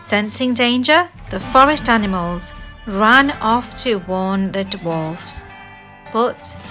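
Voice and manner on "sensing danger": ordinary delivery, nothing marked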